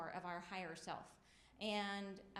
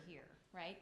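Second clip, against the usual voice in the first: ordinary talk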